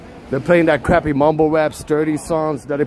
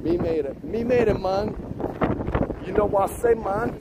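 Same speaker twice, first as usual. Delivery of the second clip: softer, higher mock Jamaican accent; fake Jamaican accent; 5 semitones higher, 6 dB quieter than usual. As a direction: celebrate quietly in put-on Jamaican English. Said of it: "My fake Jamaican".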